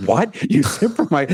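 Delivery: high-pitched mock indignation; indignant. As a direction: act out a friend's appalled disbelief. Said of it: "so indignant about how disgusting it is".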